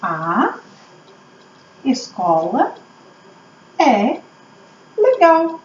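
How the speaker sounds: word by word with long pauses; slow, spaced-out sentence building; dictating while writing. Shown as a demonstration. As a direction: stretch each word as if writing it down. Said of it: "Que frase eu posso escrever?".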